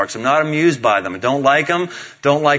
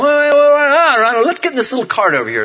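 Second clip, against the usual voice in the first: high, loud flurry easing down; hustling dad; 11 semitones higher, 4 dB louder than usual. Retold or imitated. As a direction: rush the kid along before she notices. Dad hustling daughter into cart.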